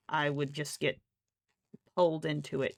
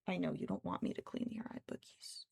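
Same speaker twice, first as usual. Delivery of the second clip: whisper; 8 dB quieter than usual. Real, not acted. Whispering to the cat.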